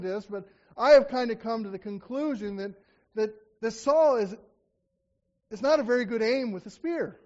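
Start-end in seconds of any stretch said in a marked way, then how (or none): none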